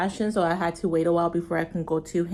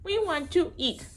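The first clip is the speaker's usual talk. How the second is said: We want to eat sung higher; higher sing-song; 7 semitones higher than usual. Real, not acted. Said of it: "repeated to baby".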